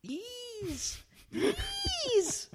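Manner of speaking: very high, drawn-out squeaky plea; mock pleading. Begging co-host over whether to part with it, mock pleading. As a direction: beg in a tiny falsetto.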